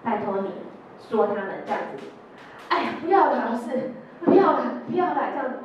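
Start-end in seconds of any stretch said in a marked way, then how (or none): 2.7-5.6 louder, higher begging; student begging the teacher, loud and higher; pleading refusal. Retold or imitated. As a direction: beg the teacher to let you off. Boy begging teacher not to.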